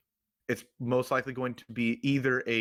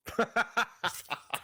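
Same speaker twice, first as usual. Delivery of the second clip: high burst of laughter; 10 semitones higher than usual. Real, not acted. Friend jokes about being sticky.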